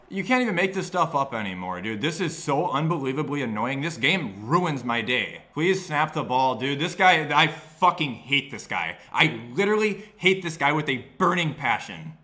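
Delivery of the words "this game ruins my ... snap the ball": ordinary talk, nothing marked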